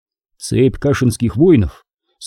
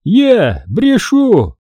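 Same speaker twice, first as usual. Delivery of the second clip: high, drawn-out indignant retort; slow growled comeback, pitched up; indignant roar; 8 semitones higher, much slower than usual. A narrator's reading. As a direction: stretch each word in affronted disbelief. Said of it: "Фомка рыкнул".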